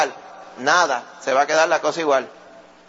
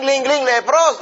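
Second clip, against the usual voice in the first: bell imitation; 8 semitones higher, 4 dB louder, slower than usual. Leper's bell and warning call.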